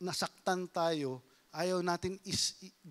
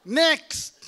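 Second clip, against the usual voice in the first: loud call; 7 semitones higher, 10 dB louder than usual. Shown as a demonstration. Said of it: "Anong next na itsura ng isang Kristiyano?".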